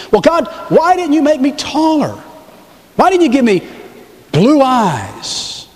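high, insistent pleading; mock complaint. Believer asks God for other looks, complaining. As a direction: whine it upward as a hammed-up grievance.

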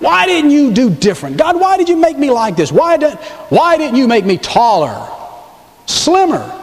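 high-pitched, pleading protest; mock complaint. Believer questions God about their looks, complaining. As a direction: plead upward in exaggerated grievance.

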